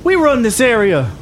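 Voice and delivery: put-on high-pitched young thug, scornful; high, cocky gang challenge; territorial gang member. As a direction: claim your turf in a high put-on voice. Game master plays gang member.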